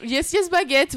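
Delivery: high, playful caricature of speaking English; self-mocking squeaky English; high-pitched English voice. Shown as a demonstration. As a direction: pipe it up high, mocking yourself. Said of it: "voix qui monte de trois octaves au-dessus".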